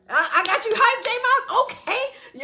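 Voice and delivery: high, loud excited cry; hyped up. Real, not acted. Cheering on Brother J Miles, real excited shout. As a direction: fire him up with real excitement.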